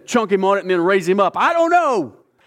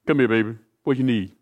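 smooth king; 5 semitones lower than usual. Acted. King's seductive reply to Esther.

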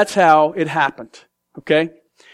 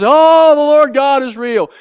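stunned convert; 7 semitones higher, 6 dB louder, slower than usual. Acted. Hitler converted, voiced.

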